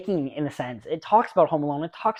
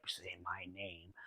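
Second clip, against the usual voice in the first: much lower, much softer, slow menacing line; Bryan Cranston; 12 semitones lower, 26 dB quieter, slower than usual. Retold or imitated. Reviewer quotes the Breaking Bad actor, low soft impression. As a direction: drop way down and slow for the villain line. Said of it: "the guy who's like".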